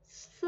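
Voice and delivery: 'स' as a soft hissed s; isolated 's' sound. Shown as a demonstration. Teacher separates the sounds, 'स' as a bare s. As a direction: sound out 'स' alone, very softly. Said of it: "वो स है".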